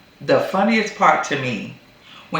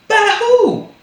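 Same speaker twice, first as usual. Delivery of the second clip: high, loud indignant demand; mock indignant shout; 8 dB louder than usual. Retelling the mortgage call, an outraged loud demand. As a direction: fire the question back in disbelief.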